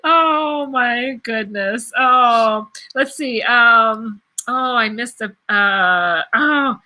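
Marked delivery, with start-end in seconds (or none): none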